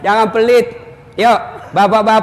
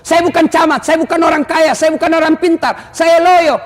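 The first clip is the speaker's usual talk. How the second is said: higher, pleading list of excuses; mock a lazy congregant begging off; whiny excuse; 6 semitones higher, 3 dB louder than usual. Congregant excusing himself to the preacher, higher whiny plea.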